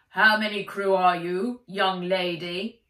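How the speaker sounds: bellowed through megaphone. A narrator's reading. Policeman calling up through a megaphone, loud bellow. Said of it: "he bellowed through an old-fashioned handheld megaphone".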